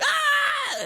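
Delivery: sudden high mock shriek. Sister shrieking at brother on her bed, imitating a high shriek. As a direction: squeal like someone jumping on her bed.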